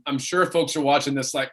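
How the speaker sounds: normal talking voice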